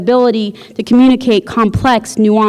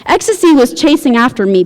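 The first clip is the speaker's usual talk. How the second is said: plain reading voice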